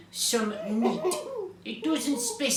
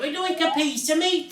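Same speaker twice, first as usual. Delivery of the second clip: high, loud storybook boom; 8 semitones higher, 6 dB louder than usual. A narrator's reading. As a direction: boom out the request. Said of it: "he boomed".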